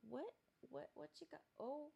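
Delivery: high, soft voice to the cat; pet talk. Real, not acted. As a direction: coo at the cat as she wanders in. Talking to her cat mid-recording.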